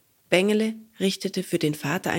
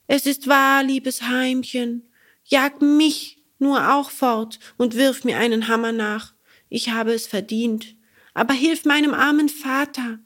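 put-on higher, louder boyish pleading, slowing on liebes Heimchen; pleading Bengele; 6 semitones higher, 3 dB louder than usual. Bengele begs the cricket to help his father. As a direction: beg for help for your father.

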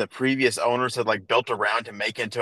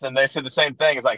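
ordinary talk

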